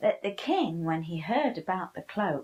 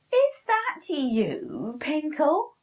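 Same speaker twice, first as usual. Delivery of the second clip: high, loud, slow witch's cry; 9 semitones higher, 6 dB louder, slower than usual. Acted. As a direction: call out shrill and slow like a witch. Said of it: "she cried".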